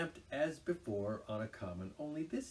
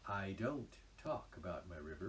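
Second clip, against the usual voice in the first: softer, lower, patient reply; patient Rat; 5 semitones lower, 8 dB quieter than usual. Acted. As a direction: calmly deny it, a little hurt. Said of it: "replied patient Rat".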